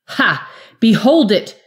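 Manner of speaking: loud, high, exultant cry; Jory shouting her triumph at the vanished wizard; triumphant scream. A narrator's reading. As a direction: scream it at the empty stone in triumph. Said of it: "she screamed to the unanswering stone".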